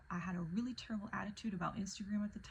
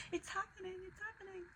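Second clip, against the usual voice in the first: tiny high squeal of excitement; hushed, thrilled squeal; retold freaking out; 11 semitones higher, 5 dB quieter, faster than usual. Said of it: "I'm, like, freaking out".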